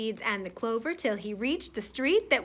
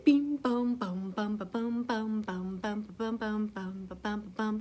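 low, soft bass bum-bum; mouthed bass line; 5 semitones lower, 8 dB quieter than usual. Pretend. Reader plays Willie the worm's bass, low mouthed plucking. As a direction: thump out a quiet, low bass riff by mouth. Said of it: "pretend you got your bass guitar".

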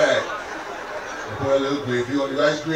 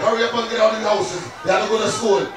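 a louder, higher mom calling to hurry; 6 semitones higher, 4 dB louder than usual. Retold or imitated. Mom rushing her son out the door, calling loudly. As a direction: hurry the kid out like a Caribbean mom. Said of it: "My mom used to wake me up".

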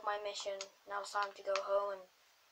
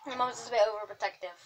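ordinary talk, nothing marked

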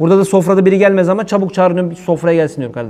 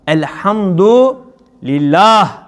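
slow drawn-out elhamdülillah; 3 semitones higher, much slower than usual. Shown as a demonstration. Demo of saying it at end.

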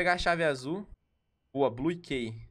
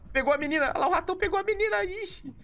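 alarmed; 14 semitones higher than usual. Real, not acted. Rat grabs the girl.

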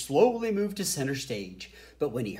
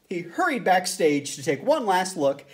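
usual speaking voice

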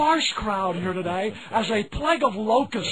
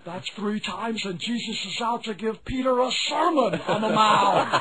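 ordinary talk, nothing marked

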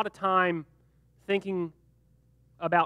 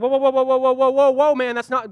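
loud, higher, frantic whoas; panicked protest; 4 semitones higher, 8 dB louder than usual. Retold or imitated. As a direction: back off in alarm. Terrified brothers protest.

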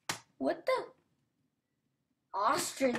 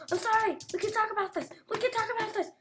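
pleading; 8 semitones higher, much faster than usual. Real, not acted. Pleading with attacking cat.

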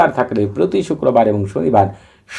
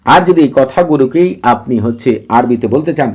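usual speaking voice

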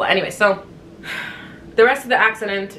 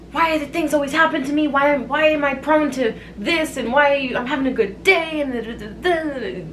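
high-pitched, exaggerated self-pitying whine; mock woe-is-me; 6 semitones higher than usual. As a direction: imitate someone bemoaning their bad luck. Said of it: "woe is me".

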